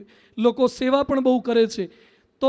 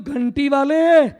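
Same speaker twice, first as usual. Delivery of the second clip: drawn-out, louder mock outrage; exasperated retort; 6 dB louder, slower than usual. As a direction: throw the host's word back at him, stretched and loud. Exasperated comeback to host's advice. (અકળાઈને લંબાવેલો જવાબ)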